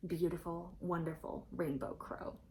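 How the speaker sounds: usual speaking voice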